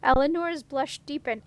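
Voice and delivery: ordinary delivery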